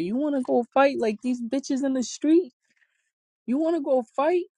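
high-pitched mock dare, imitating mom; confrontational challenge. Mom daring her child who tested her, confrontational.

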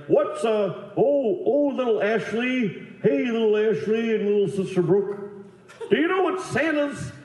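high, hammed-up Santa greeting; jolly mall Santa. Santa greeting two little girls, high jolly voice. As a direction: coo to the little ones as Santa. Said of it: "his kid jokes were like".

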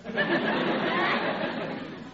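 high breathy chuckle after being caught out; sheepish laugh. Acted. Riley caught out by his wife, sheepish high laugh.

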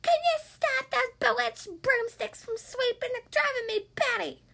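high, loud, drawn-out character shout; shouting back. A narrator's reading. Bella yells back at her neighbour Boris, high-pitched shout. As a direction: holler shrilly across at the neighbour. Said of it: "Bella shouted back".

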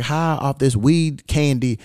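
usual speaking voice

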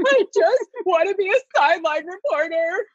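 high-pitched imitation of her own pleading; hammed-up retold plea to a parent; mock whiny protest. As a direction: wail it in a small high voice, playfully. Retells her protest to her mother.

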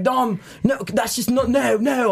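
a hint of Jeff's English-accented telling-off; mock English scolding. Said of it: "even if Jeff and Nigel are yelling".

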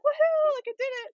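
high, loud whoop of triumph; celebrating. Retold or imitated. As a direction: whoop it like crossing the finish line. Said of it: "and I'm like".

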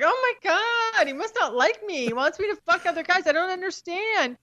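put-on high, anxious rush of a confused girlfriend; panicked young self. Herself recalling her confused panic about the boyfriend. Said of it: "at first I was like".

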